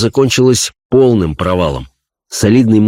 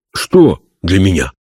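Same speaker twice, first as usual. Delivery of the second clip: surprised soldier. Acted.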